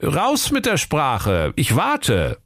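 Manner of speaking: drawn-out police impatience; impatient. A narrator's reading. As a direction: press them like a waiting inspector. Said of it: "rief der Kommissar ungeduldig".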